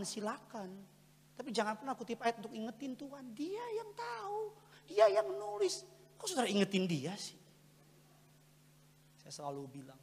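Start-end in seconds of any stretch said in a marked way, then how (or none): 3.3-7.4 very high falsetto protest; mock-exasperated squeal. Preacher mocks people who remind God of verses, exasperated falsetto. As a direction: leap into a squeaky, stretched falsetto.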